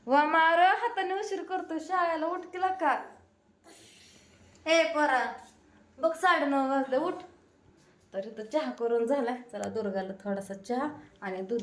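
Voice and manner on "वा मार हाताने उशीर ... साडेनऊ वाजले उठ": loud, high hurry-up; scolding call. Real, not acted. Mother hurries son, late for school, with a loud scold.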